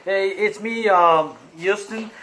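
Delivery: usual speaking voice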